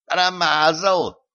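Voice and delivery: high, drawn-out squeak; squeaky jeering. A narrator's reading. Tiny knights jeering from a mouse hole, squeaky. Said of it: "запищали рыцари".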